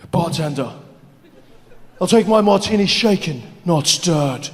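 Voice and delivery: a light take on a suave British spy, laughing through it; hammed-up Bond order. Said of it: "to place that iconic order".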